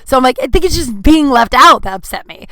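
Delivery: ordinary talk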